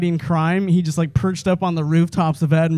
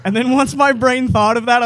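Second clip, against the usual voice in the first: ordinary talk, nothing marked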